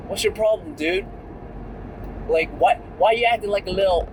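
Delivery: lightly voiced street tough squaring up; inner gangster wannabe. At the gym guy who keeps glaring, a lightly voiced gangster challenge. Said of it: "my inner Vietnamese gangster wannabe was like".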